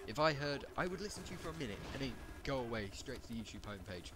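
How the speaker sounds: ordinary talk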